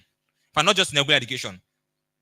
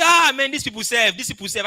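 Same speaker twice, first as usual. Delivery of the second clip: much higher and louder, hammed-up grumbling; mock complaining; 8 semitones higher, 5 dB louder than usual. Speaker imitating people who complain about foreigners, mock grumbling. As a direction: moan about them like a fed-up customer. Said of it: "So I'll just say".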